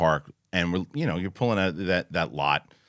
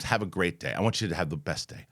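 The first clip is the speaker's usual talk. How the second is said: lower, softer, deadpan niceness; insistent well-wishing; 6 semitones lower, 7 dB quieter than usual. Retold or imitated. Driver needling an angry dad without cursing, restrained niceness. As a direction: wish him well in a low, restrained voice. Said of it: "I said to him a million times".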